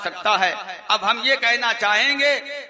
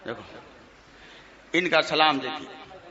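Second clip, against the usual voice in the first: ordinary delivery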